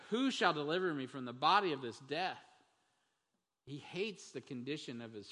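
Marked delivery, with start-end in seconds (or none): 0.1-2.4 raised anguished plea, louder; crying out. Retold or imitated. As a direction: cry out the question in distress. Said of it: "he cries out further".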